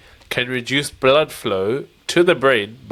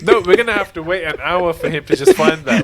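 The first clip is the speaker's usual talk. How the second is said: laughing; 6 semitones higher, 3 dB louder, faster than usual. Real, not acted. Teasing while laughing.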